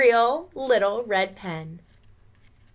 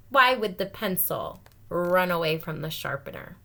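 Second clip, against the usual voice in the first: ordinary delivery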